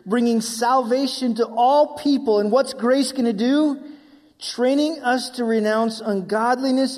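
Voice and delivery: loud, driving pulpit delivery; full-force preaching. Acted. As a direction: preach it out to the whole congregation. Preaching the scripture passage.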